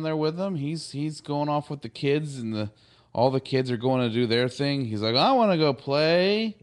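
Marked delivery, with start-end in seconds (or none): 5.2-6.6 higher, louder mock-eager whine to go play; eager kid. Wesley wants to join the kids, eager. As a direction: play-act an excited boy, pitched up.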